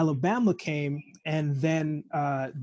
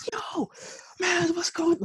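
imitating an excited, much higher shout of greeting; slower than usual. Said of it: "Like, he's excited".

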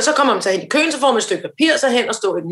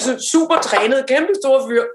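ordinary talk, nothing marked

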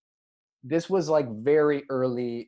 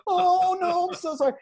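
put-on much higher, loud, childlike squeal of sorry; panicked kid apology; 4 dB louder than usual. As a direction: squeak it out like a mortified little boy.

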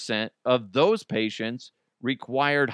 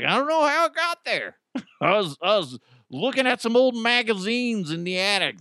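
high-pitched protest of innocence; mock defensive excuse; 9 semitones higher than usual. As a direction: plead innocence in a hammed-up high voice. Patient makes an unlikely excuse.

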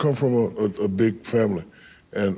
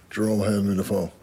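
usual speaking voice

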